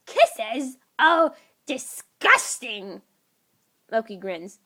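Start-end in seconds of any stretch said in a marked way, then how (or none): 0.1-3.0 put-on higher, louder, slow childish disgust; grossed-out kid. Child reacting to kisses, higher slow disgusted whine. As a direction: drag out the yuck like a kid.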